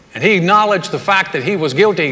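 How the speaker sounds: ordinary talk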